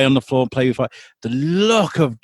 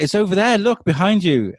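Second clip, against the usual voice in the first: put-on higher eager child voice; 6 semitones higher than usual. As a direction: lightly voice an excited kid. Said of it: "he'll be like".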